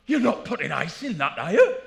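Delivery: loud, rising disbelief; scandalised snob. Retold or imitated. Whiskey snob loudly scolding a drinker adding ice. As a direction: scold them loudly for ruining it.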